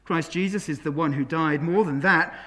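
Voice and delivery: ordinary talk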